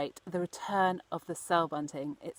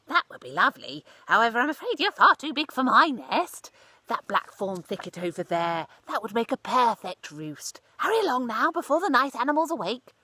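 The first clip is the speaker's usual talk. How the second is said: mouse voice; 4 semitones higher, 5 dB louder than usual. Acted. Mouse advises Cyril kindly.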